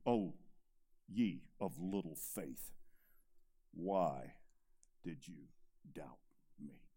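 soft, low, fading reproach; gentle rebuke. Retold or imitated. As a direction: hush it down to a tender reproach. Jesus gently rebuking Peter.

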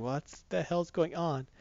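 slow, soft, lightly voiced bewilderment; mock wondering, hushed and slow; puzzled dog voice. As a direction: draw it out quietly, like a baffled pet. Voicing the wondering dog again.

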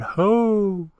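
high echoing call; a far-off echo throwing the call back; echo's shout. A narrator's reading. The echo answers Tutiki's call, high and far off. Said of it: "The echo raised its head ... and shouted".

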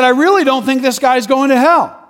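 high, loud insistence; defiant objection. Retold or imitated. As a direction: stand up to the preacher before the crowd. Retold confrontation with the preacher.

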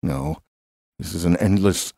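ordinary delivery

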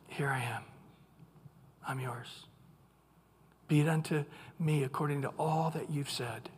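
very soft, low, humble acceptance; quiet, submissive reply with reverent pauses; soft surrender. Acted. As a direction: hand yourself over in a near-murmur. Mary yields to God's call.

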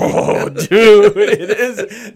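laughing loudly while speaking. Real, not acted. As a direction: laugh it out loud, high and bright. Laughing at a funny name.

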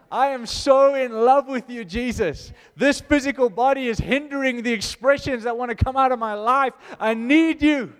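loud, high, aching cry of love to Jesus; fervent loud prayer, voice lifted high; lovesick prayer. Acted. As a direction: pour it out like a worshipper overflowing.

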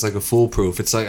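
usual speaking voice

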